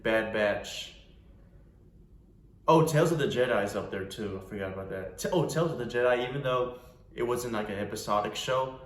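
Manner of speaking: usual speaking voice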